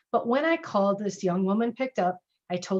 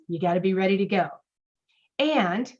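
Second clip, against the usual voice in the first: ordinary talk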